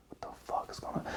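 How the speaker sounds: hushed, voiceless whisper. Retold or imitated. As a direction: whisper it like the bewildered kids. Other kids voiced as hushed.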